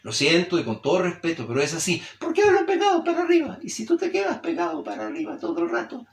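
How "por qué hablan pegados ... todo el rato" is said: pitched far up, stuck at the top; shrill squeaky voice. Shown as a demonstration. Teacher mocking shrill habitual talkers, squeaky high voice. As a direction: demonstrate a shrill voice jammed high. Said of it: "tu voz suena así de chillona".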